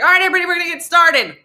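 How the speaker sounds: loud, fast, high presenter call; rally the room, fast; hyped announcer. Retold or imitated. Presenter starting the seminar, loud and fast.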